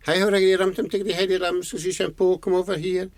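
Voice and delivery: high, very fast rattled-off name and order; summoning mother. Acted. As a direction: call the children over in a rapid rush. Mother summons naughty boys by name.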